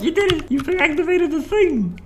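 high, bright mock cheer; cheery game voice. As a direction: squeal it like a proud toy. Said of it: "they're like".

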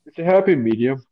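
said through a yawn; words stretched by a yawn. Real, not acted. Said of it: "oh, sorry for the yawn".

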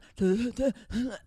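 a quick incredulous laugh; amused laugh. Real, not acted. Laughs at the memory.

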